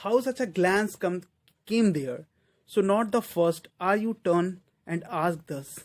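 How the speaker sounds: loud, slow verse declamation; deliberate, projected poem reading; poem recitation. Acted. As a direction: declaim slowly, with firm volume and pauses.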